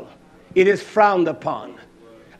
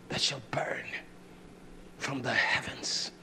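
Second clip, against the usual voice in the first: whispered, far softer and lower; hushed warning; 9 semitones lower, 17 dB quieter than usual. Real, not acted. Preacher warning of fire from the heavens, hushed. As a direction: drop to an ominous whisper.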